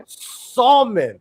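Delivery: Salmon said SAL-mon, l sounded; Salmon said loud, with the l. Shown as a demonstration. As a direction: pronounce the l in Salmon. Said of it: "There's no such thing as salmon".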